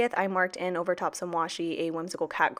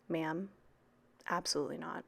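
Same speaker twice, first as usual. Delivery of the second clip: mock whispered no; 9 dB quieter than usual. Telling fiancée no.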